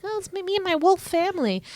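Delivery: put-on high-pitched kid's make-believe voice; lightly hammed-up little kid at play; child playing pretend. Voices childhood pretend wolf game.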